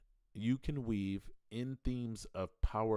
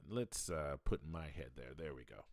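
normal talking voice

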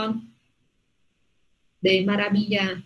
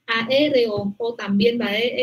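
usual speaking voice

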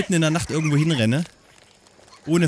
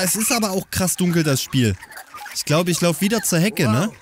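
ordinary delivery

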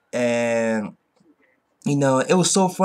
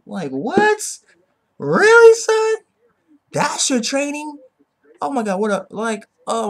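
much higher and louder, disbelieving; incredulous shout; 13 semitones higher, 6 dB louder than usual. Real, not acted. Reviewer reacting to the training routine, incredulous shout. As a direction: shout your disbelief at the joke.

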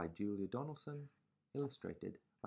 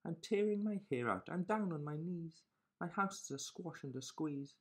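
put-on frantic old lady; 6 semitones higher than usual. A narrator's reading. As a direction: cry out at wit's end. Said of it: "The little old lady cried".

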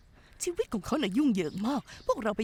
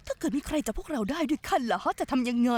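ordinary talk, nothing marked

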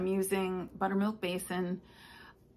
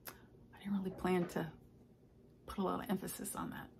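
hushed reply; 8 dB quieter than usual. Retold or imitated. Telling the kids about their stockings, hushed and sheepish. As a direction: drop to a near whisper, softer. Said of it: "And I'm like".

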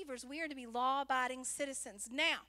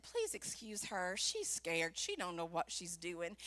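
low, softer imitation of a husband; mock-husband, pitched way down; husband's excuse; 9 semitones lower, 5 dB quieter than usual. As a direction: apologize for a flustered wife in a deep voice. Voices husband excusing her.

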